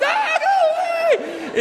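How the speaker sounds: very high, drawn-out yell; panicked shout. Retold or imitated. Driver yelling at a squirrel, very high, slow shriek. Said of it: "and I'm like".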